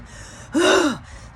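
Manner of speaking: sudden high, loud gasp; a startled intake of breath; sharp gasp. Real, not acted. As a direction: gasp as if struck by a feeling. Sudden gasp while sensing spirit.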